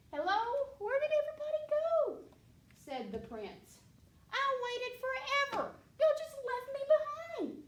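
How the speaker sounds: put-on high, loud child voice; a small boy calling out, left behind; little prince. Little prince complaining that everyone left him, high and loud.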